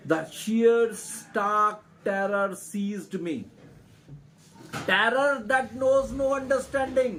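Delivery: slow, high, loud dramatic reading of terror; dramatic story reading. A narrator's reading. Teacher reading the drowning story aloud to students, slow and dramatic. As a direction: draw out every word as if reliving the fear.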